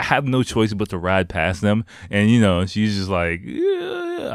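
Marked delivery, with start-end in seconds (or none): 3.4-4.3 squeaky high mock whimper of the crying girl. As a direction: play the teary girl, pitched way up. Crying girl voiced in story.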